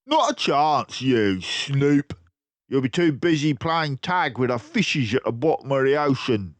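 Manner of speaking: loud crook voice; menacing crook. Acted. Crook threatening to drown Basil. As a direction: sneer the threat.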